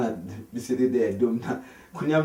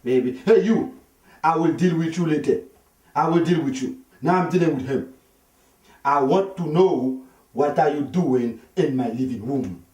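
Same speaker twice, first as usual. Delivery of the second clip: furious husband; 6 semitones higher, 7 dB louder than usual. Retold or imitated. Husband silencing his wife, then shouting at the young man. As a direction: put her off and turn the fury on him. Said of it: "his wife was like".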